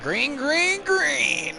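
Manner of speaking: green flag cried out in a very high falsetto; high falsetto call. Pretend.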